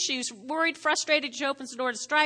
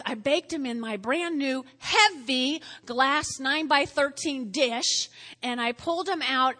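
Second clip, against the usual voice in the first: ordinary talk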